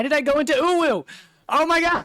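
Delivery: high, loud, startled outburst; shocked squeal. Real, not acted. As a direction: yelp in disbelief at the mistake. Shocked at own mistake.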